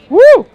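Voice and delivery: loud high woo at the heat; excited woo. Real, not acted. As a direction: whoop at the spicy kick. Reaction to spicy heat.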